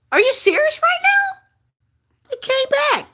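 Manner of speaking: can't believe the card came back; stunned disbelief. Real, not acted. Reader to viewers when the card reappears, astonished.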